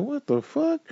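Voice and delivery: stunned disbelief. Retold or imitated. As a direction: hit it like you can't believe your ears. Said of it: "And I'm like".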